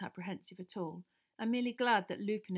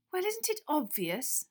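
slow, higher know-it-all; superior; 8 semitones higher, 4 dB louder, slower than usual. A narrator's reading. Hermione lording it over Ron, superior. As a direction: drip superiority. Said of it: "maddening superiority".

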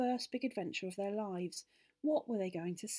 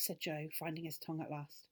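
usual speaking voice

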